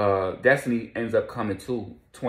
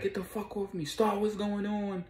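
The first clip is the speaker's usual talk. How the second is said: high, frantic imitated panic; panicked struggle; 12 semitones higher than usual. Vicky grabbed by the guys, voiced high and frantic. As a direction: pull free in a panic. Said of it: "So Vicky was like".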